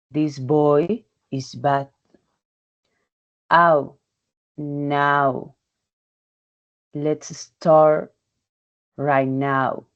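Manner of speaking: ordinary talk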